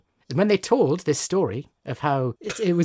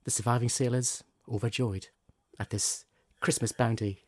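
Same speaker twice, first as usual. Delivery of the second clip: fireside storytelling; 4 semitones lower than usual. Acted. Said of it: "You've got your storytelling voice on".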